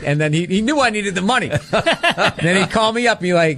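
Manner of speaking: ordinary talk, nothing marked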